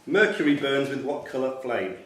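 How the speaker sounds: ordinary talk, nothing marked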